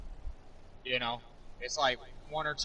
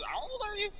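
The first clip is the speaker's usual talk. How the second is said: much higher put-on voice, teasing question; mock incredulous brother. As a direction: squeak it out like a mocking brother. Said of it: "he goes".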